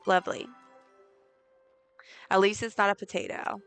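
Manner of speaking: usual speaking voice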